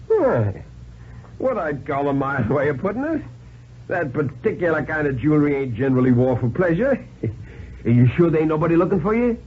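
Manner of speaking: a sly hobo sizing up a stranger; folksy tramp voice. A narrator's reading. Tramp questioning the handcuffed stranger, amused and wary.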